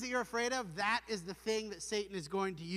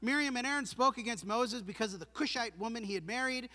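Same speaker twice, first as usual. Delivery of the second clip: ordinary delivery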